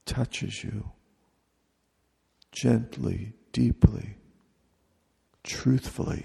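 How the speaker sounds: ordinary talk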